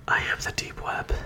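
eerie whispered villain voice; hammed-up spooky whisper; whispered deep web. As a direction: whisper it like a menacing entity. Voicing the deep web as character.

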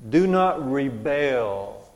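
very slow, weighty reading; emphatic scripture command. Acted. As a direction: draw out each word of the command. Reads a biblical command emphatically.

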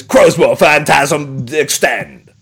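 slow booming game announcer; slow, dramatic title call. Retold or imitated. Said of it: "they have the announcer voice".

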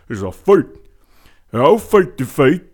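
loud, slurred movie tough-guy impression; Sylvester Stallone. Host telling folks it's a fight, in a loud Stallone impression. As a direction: shout it like a punchy film boxer. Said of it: "I'm gonna use my Sylvester Stallone voice".

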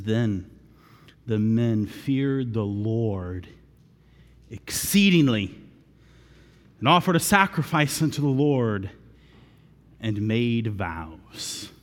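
low, slow reverent reading; scripture reading. A narrator's reading. Preacher reads verse sixteen, slow and low. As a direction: read the verse with weight.

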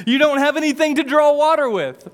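playful. Shown as a demonstration. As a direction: tease him like it's a joke. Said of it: "a little playful".

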